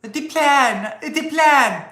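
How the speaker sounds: high, mock-slow French-accented parody of Harris. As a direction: ham up her line in a high French-tinged voice. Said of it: "but with a French accent".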